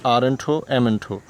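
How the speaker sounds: normal talking voice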